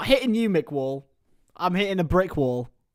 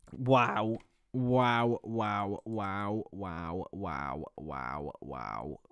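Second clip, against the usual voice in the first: each wow lower and softer till it dies away; fading wows; 10 semitones lower, 12 dB quieter, much slower than usual. Real, not acted. Streamer trailing off in awe, fading out. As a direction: let the wows sink to nothing.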